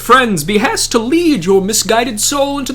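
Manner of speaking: lofty, theatrical holy man addressing a scholar; grand saintly NPC. Acted. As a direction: proclaim it like a pompous festive saint.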